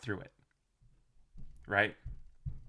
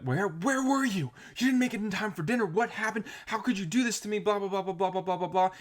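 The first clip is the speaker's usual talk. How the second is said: high, shrill-pitched nagging rant, fast at first; mock angry spouse; 11 semitones higher, 4 dB louder than usual. Worried spouse scolding the late partner, in a high mock rant. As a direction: nag like an upset partner.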